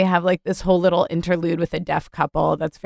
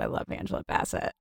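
whispered; 11 dB quieter, faster than usual. Real, not acted. Quiet fond aside.

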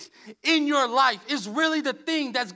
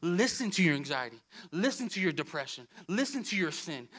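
low, coaxing voice of the tempter; quietly insistent tempter; the enemy's voice; 9 semitones lower, 5 dB quieter than usual. Acted. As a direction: drop low and urge like the enemy. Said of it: "what the enemy wants you to do is say".

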